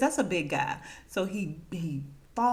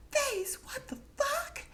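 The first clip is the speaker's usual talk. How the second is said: very high, slow, drawn-out disbelief; acted shock; slower than usual. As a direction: squeal it in mock outrage. Acts Patty's shock at catching them.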